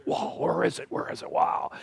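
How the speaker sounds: hushed breathy wonder at the eagle; soft amazed gasp, barely voiced; awed whisper. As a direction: whisper it like spotting something majestic. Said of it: "They just go".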